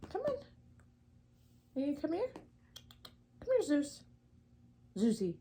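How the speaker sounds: high sing-song call to the cat; coaxing pet voice. Real, not acted.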